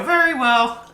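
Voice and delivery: high-pitched fairy voice. Acted. Fairies giving their verdict to the entertainers, high-pitched. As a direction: pronounce judgement in a high little fairy voice. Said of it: "They go".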